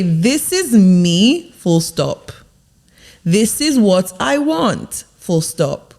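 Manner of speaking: slow, firm statements, each closed with a full stop; speaking down with conviction. Shown as a demonstration. Coach showing how to talk about yourself, with conviction. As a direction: declare it, landing every phrase firmly. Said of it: "Speak down instead of speaking up".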